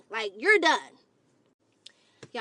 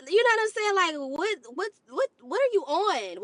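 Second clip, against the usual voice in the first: high, rising what what; incredulous; 6 semitones higher than usual. Real, not acted. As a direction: stammer in disbelief, pitch climbing. Incredulous rant at date.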